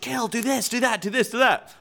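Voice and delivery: high-pitched barrage of coaching orders; intense coach imitation. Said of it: "there was a huge intensity".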